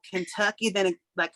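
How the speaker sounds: usual speaking voice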